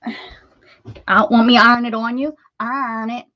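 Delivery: real Pittsburgh accent popping out. Real, not acted. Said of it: "That was my Pittsburgh accent coming out".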